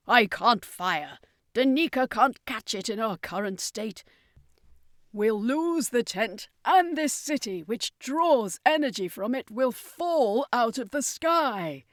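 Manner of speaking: character voice refusing to fire and explaining why; circus master, worried. Acted.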